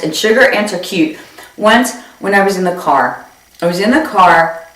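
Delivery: usual speaking voice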